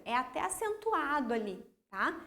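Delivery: ordinary talk, nothing marked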